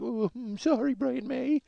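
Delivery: high, pouty little sorry; mock sheepish apology. Autograph seeker told off by [name], mock sheepish. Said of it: "this guy made, like, a puss face".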